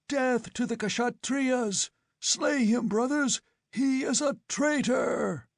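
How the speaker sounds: war cry. A narrator's reading. Mob calling for his death.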